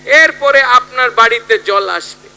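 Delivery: loud, high-pitched political oratory; rally speech. Acted. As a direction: address the crowd with drawn-out, punched phrases. (জনসভার জোরালো রাজনৈতিক ভাষণ)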